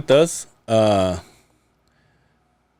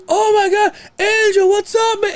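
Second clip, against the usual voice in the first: imitating Jerry's squeal, very high and louder; excited high voice; 4 dB louder than usual. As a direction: recognise a friend and squeal up high. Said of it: "Doesn't he have the high voice?".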